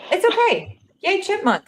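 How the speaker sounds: chipmunk-pitched audio glitch; squeaky chipmunk sound; helium voice. Real, not acted. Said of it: "you just sound like a chipmunk".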